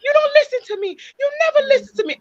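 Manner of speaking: high, crying plea; high tearful repeat of a child's complaint; tearful pleading. Retold or imitated. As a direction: retell the tearful outburst, voice breaking high. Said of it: "And I kept repeating".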